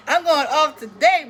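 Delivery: laughing through the words, slow. Real, not acted. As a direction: laugh it out, drawn out. Laughing at her own rant.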